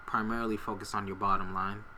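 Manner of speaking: ordinary talk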